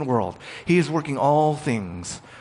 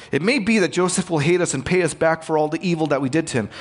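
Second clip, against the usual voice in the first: rattled off quickly, same words faster; fast rereading; 4 semitones higher, faster than usual. Shown as a demonstration. Pastor rereads the verse to his listeners, rattling it off fast. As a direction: rush through the brothers' worry.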